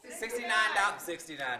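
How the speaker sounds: usual speaking voice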